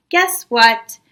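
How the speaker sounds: ordinary delivery, nothing marked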